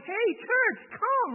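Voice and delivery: high, loud call to the group. Retold or imitated. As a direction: call them over from across the room. Pastor calls out to church members.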